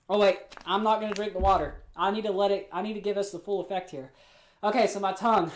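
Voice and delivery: ordinary talk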